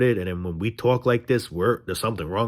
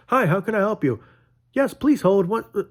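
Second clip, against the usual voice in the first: high chirpy customer-service voice; cheery worker; 10 semitones higher than usual. Pretend. Vlogger acting a worker at a job, high chirpy service voice. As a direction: play the fake-happy clerk.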